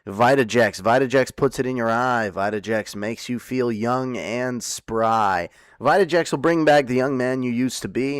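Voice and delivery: punchy mock infomercial pitch; sponsor ad read. Streamer pitching Vitajex to viewers as a mock sponsor, hammy ad read. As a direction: sell it like a hammy pitchman, leaning into key words.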